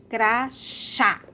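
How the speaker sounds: "Crachá" split, strong falling CHÁ; "Crachá" in two separate beats, last one stressed; stressed final syllable. Shown as a demonstration. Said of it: "É o chá".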